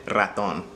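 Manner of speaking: raton with a trilled initial r; raton, first r rolled. Shown as a demonstration. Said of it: "help you pronounce that R".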